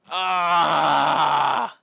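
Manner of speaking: loud raw scream of frustration; loud anguished yell; frustrated scream. Real, not acted. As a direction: let out a real howl of anger. Frustration after losing work.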